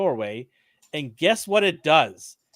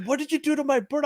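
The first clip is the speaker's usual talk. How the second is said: high-pitched imitation of an upset friend; mock accusation; 10 semitones higher, faster than usual. Friend confronts narrator over his dead pet bird, mock accusation.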